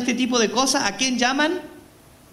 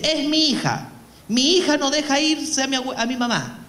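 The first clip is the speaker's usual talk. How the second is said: higher-pitched pleading mother voice; lightly voiced distressed mother; 6 semitones higher than usual. Mother tells the priest about her daughter, higher-pitched plaint.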